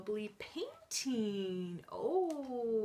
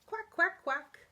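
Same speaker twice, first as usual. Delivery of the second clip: high, loud little quacks; happy duckling quack; 7 semitones higher, 7 dB louder than usual. Acted. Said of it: "When I'm feeling happy, I say".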